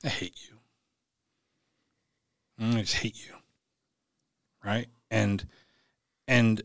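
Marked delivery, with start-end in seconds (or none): 0.0-0.6 softer, flat-out quiet "I hate you"; quiet inner hatred toward the crowd that didn't help. Retold or imitated. Looking at these people like. 2.6-3.3 whispered "I just hate you", no voice; whispering hatred at the beer-drinking crowd. Retold or imitated. Looking at these people like.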